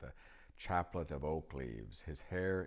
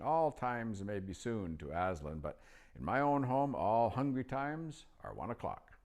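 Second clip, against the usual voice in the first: put-on higher, louder old wizard voice; old magician; 6 semitones higher, 4 dB louder than usual. A narrator's reading. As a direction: pitch up into the chatty old magician. Narrator voicing old magician.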